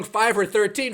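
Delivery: usual speaking voice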